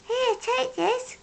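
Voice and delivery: high, slow, gentle offer; kind hedgehog. A narrator's reading. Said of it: "he said".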